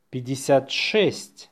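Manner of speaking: natural-speed dictation. Shown as a demonstration. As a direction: run the number together briskly.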